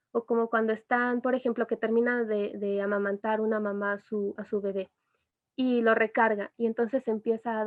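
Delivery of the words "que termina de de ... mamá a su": ordinary talk, nothing marked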